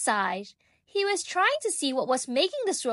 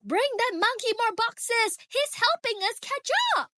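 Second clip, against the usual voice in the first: much higher, urgent call across the floor; excited yell; 11 semitones higher than usual. A narrator's reading. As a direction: cheer the monkey on as you call. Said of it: "Someone noticed and yelled".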